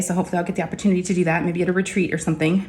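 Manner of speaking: ordinary delivery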